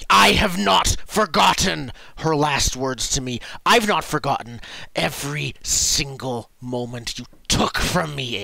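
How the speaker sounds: loud, wronged warrior's fury at the start; furious accusation. Acted. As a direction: throw the old wrong in their face. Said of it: "almost like fury".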